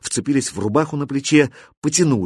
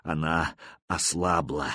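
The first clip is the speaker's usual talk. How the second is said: low, soft, slow dying whisper; faint whisper; 7 semitones lower, 8 dB quieter, slower than usual. A narrator's reading. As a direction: barely voice it, slowly. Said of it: "прошептал болотник".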